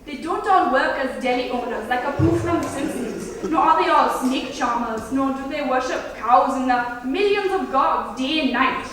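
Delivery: mock Indian accent, slowing near the end; lightly put-on Indian accent like Apu. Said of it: "Okay, take Indians".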